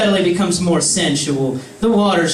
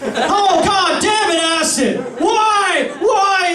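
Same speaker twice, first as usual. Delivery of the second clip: high, wailing protest; hammed-up exasperated yelling; anguished outburst; 12 semitones higher, slower than usual. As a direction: cry out in mock horror. Acted horror at Acid's remark.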